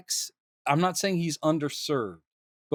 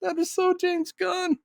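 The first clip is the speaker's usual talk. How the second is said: much higher, delighted; high excited gush. Real, not acted. Host gushing to cohost about the director, high delighted gush. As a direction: squeal it with glee.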